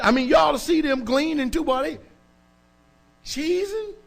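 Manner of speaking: incredulous mocking preacher aside; scornful mockery. Real, not acted. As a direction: sneer at what he saw on TV. Mocking a couple on the news.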